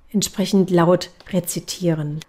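ordinary delivery

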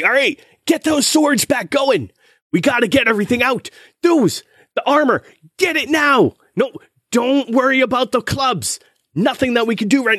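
high-pitched foreman barking orders; yelling. Acted. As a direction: yell rapid commands over a noisy forge. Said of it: "he's just yelling".

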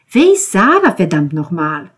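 exploding with impatience; furious demand. Acted. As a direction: demand the answer at full pitch. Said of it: "Während Georgia wieder anfing zu schreien".

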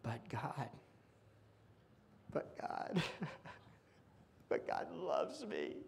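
whispered, then a soft laugh; hushed awe. Real, not acted. Preacher whispering his relief to the congregation. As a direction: breathe it out in wonder.

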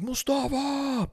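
high, drawn-out call, kept soft; mock distant calling. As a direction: imitate shouting for a lost child without the volume. Father calling out for lost son.

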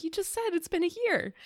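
high, fast incredulous retort. Retold or imitated. To a TV mom's odd answer, quick high disbelief. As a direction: fire it back in disbelief. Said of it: "Because I was like".

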